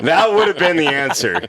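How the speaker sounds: loud laughing, high. Real, not acted. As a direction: burst out laughing. Laughing at joke.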